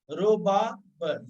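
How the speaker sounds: rōbāb drawn out very slowly, syllable by syllable; slowly sounded out. Shown as a demonstration. Teacher to students spelling a word, slowly sounded out. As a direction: stretch रोबाब out slowly as you spell it. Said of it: "फिर बे है".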